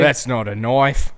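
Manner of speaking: slow mock Australian accent quoting the film. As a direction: drawl it like a movie Aussie. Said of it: "Australian accent".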